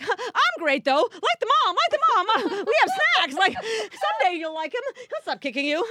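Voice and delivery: high, loud, eager mom voice pleading for approval; eager people-pleaser. Retold or imitated. Mother coaxing her son's classmates, high and overeager. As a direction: mom trying too hard to please.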